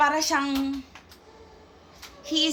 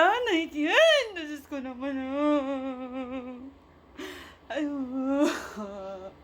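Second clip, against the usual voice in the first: crying; much slower than usual. Real, not acted. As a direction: fall apart on the words, tearful. Breaking down over vision.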